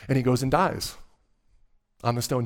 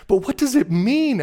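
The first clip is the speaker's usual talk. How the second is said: high, loud, amazed question; bewildered Susan; 12 semitones higher, 9 dB louder than usual. A narrator's reading. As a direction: cry it out in wonder as Susan.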